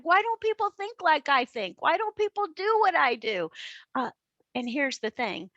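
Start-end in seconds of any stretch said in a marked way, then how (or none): 0.0-3.5 high, frustrated complaining; mock despair at the team; higher exasperated lament; exasperated whine. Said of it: "I'm like".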